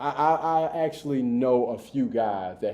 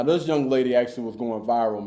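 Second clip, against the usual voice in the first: ordinary talk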